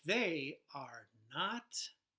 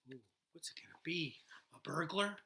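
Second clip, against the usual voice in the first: hushed suspense; 2 semitones lower, 4 dB quieter, faster than usual. Acted. Suspense for young listeners.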